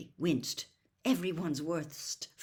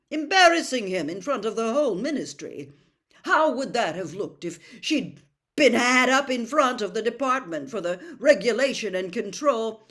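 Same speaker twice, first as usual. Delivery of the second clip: put-on higher, louder pompous young man; indignant Percy; 5 semitones higher, 11 dB louder than usual. A narrator's reading. Percy defending Mr Crouch to his family, indignant. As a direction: puff up with self-righteous indignation. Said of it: "Percy swelled indignantly".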